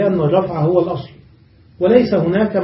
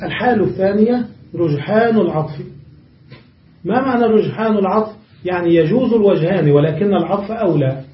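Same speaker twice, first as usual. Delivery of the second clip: usual speaking voice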